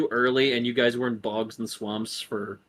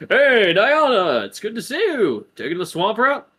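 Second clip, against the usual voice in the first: guard greeting; 9 semitones higher, 5 dB louder than usual. Acted. Guard greets NPC Diana.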